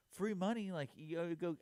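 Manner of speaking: laughing, eager urging; excited buddy. Retold or imitated. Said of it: "he's like".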